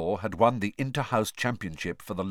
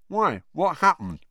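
higher, talking round a chunk of steak; mouth full; 9 semitones higher, 5 dB louder than usual. Acted. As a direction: speak through a mouthful. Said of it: "through a sizable chunk of steak".

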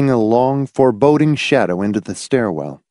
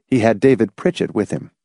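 ordinary delivery

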